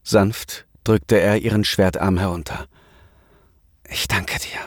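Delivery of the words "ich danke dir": soft breathy whisper of thanks; voiceless grateful whisper. A narrator's reading. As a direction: whisper it close to her ear. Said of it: "flüsterte er ihr zu".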